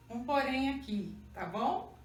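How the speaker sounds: usual speaking voice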